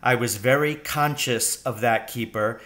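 ordinary delivery, nothing marked

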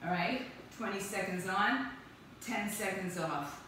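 ordinary delivery

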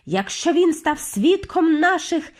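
emphatic high witch's threat; hissing. A narrator's reading. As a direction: command the hunt with venom. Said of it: "сичала верховна відьма".

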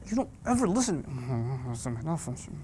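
low, slow nagging mother; a mild scolding mother voice; mom scolding. Retold or imitated. Mother scolding her child, slow and low. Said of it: "The mom says".

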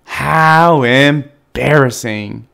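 loud, slow, drawn-out mortification; groaned, heavy embarrassment; embarrassed groan. A narrator's reading. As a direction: drag it out in dismay. Bat fails at landing.

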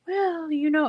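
a hint of a gentle, thoughtful trainer; soft-spoken, pensive trainer. Mum imitating her son's Irish trainer, mildly pensive. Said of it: "he's so soft-spoken and pensive".

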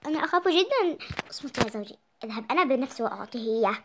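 usual speaking voice

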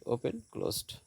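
ordinary talk, nothing marked

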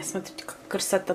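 softly whispered admiration. Real, not acted. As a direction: whisper it to the camera. Showing food to camera.

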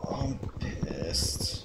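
low voiceless whisper of anger. Real, not acted. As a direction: breathe the words out in quiet fury. Frustrated gamer whispers to himself.

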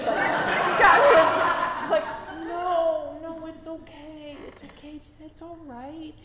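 hint of a kind elderly lady calming you down, no, it's okay; reassuring tiny old lady.

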